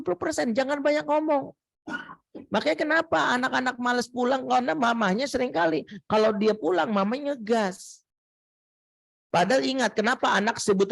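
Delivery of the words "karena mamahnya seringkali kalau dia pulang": ordinary delivery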